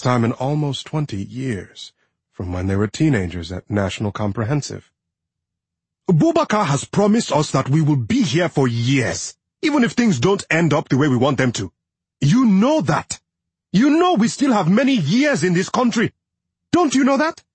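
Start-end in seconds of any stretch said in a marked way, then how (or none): 6.1-17.4 insistent, raised pleading husband; arguing. A narrator's reading. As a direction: press her to believe him. Husband arguing with his wife.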